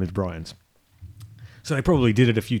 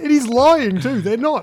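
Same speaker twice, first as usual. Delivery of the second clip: incredulous outburst; 11 semitones higher, 4 dB louder, slower than usual. Real, not acted. Laughing, exasperated outburst.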